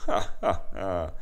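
loud scornful ha ha ha; mocking laugh. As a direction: laugh the idea off. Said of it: "Der Schakal spottete". (spöttisches Lachen)

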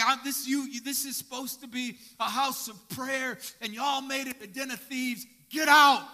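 loud, high angry outburst ending in a yell; Jesus clearing the temple, yelling at the sellers; furious shout. Pretend.

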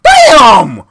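high, loud raging yell; furious shout. Real, not acted. Gamer raging at friend for reaching behind him, furious shout. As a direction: scream at him, completely fed up.

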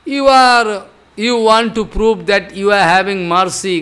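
ordinary talk, nothing marked

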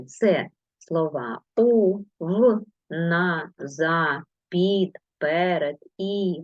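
ordinary talk, nothing marked

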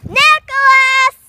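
long loud call across the street; shouting a name. Real, not acted. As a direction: call his name out far. Calling out to friend.

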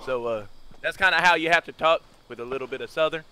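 put-on Hamlin's southern accent. Gamer rotating out of Shifty in a quiet southern impression. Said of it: "like, a southern accent".

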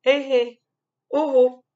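sing-song warning from mom; mother scolding. Acted. Mom playfully warns the child about candy, high mock scold. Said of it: "Elle me dit".

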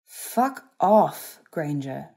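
forceful first words, falling away; grimacing rebuff. A narrator's reading. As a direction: push them off through pain. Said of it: "Malfoy said, grimacing".

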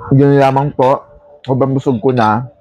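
talking while chewing; muffled through food; mouth full, chewing. Real, not acted. Seen 2: Spoon to mouth at -2; cheeks puffed, lips closed chewing early.

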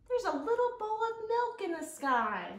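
high, wondering little kitten voice; light kitten thinking out loud; kitten's thought. Acted. Kitten speaks to herself, high and wondering. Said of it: "she thought".

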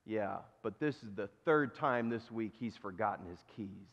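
acted matter-of-fact grumble; unimpressed owner. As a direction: answer the excitement with understated weariness. Owner's unimpressed punchline reply.

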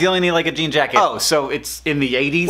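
ordinary delivery

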